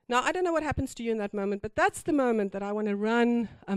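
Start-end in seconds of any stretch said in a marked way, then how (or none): none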